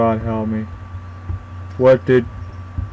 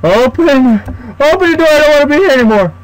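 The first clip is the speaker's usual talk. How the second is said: much higher, fast panicked yelling; desperate shouting; 10 semitones higher, faster than usual. Real, not acted. Player trapped behind a locked door, panicked shouting. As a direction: beg the door to open.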